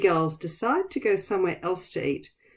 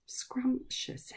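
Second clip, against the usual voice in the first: whispered word; 6 semitones higher, 11 dB quieter than usual. Shown as a demonstration. Teacher whispering a new word to children, whispered. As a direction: whisper "Scrumptious" with no voice, each syllable clear. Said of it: "Can you say scrumptious".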